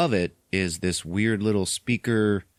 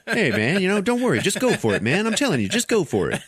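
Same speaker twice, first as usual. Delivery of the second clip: high-pitched put-on businessman voice; alien pep talk; 10 semitones higher than usual. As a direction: egg a coworker on in a cartoonish voice. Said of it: "the alien is like".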